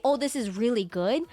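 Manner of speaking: ordinary delivery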